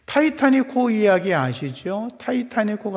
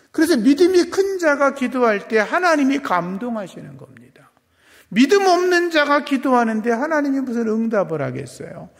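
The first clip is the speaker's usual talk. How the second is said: raised, higher preaching voice; impassioned preaching; 8 semitones higher, 6 dB louder than usual. Real, not acted. Pastor urging believers to pray boldly, impassioned preaching. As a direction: drive it out loud to the congregation.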